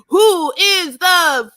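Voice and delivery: mock confrontation. [name] storming into men's quarters.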